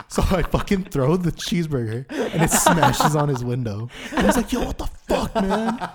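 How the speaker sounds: loud, high telling through laughter; laughing. Real, not acted. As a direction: barely get the story out for laughing. Laughing while telling the climax.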